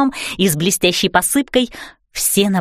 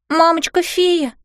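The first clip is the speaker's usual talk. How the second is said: shaky voice; 8 semitones higher, slower than usual. A narrator's reading. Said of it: "сказала я дрожащим голосом".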